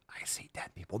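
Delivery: hushed, voiceless whisper; movie quote whisper. Retold or imitated. Host quoting The Sixth Sense, hushed whisper. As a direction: whisper it like a secret confession. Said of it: "Is this like The Sixth Sense".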